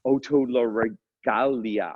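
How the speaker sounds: slow, attempted Spanish pronunciation; Spanish accent. Shown as a demonstration. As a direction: sound out the long word with a Spanish accent. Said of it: "all of our work into Spanish".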